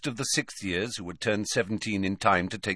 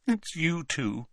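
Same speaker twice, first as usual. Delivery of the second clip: put-on high, slow, disappointed ghost girl; slow, high glum whine; 8 semitones higher, 4 dB quieter, slower than usual. A narrator's reading. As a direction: let it drag out glumly, high-pitched. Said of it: "she said glumly".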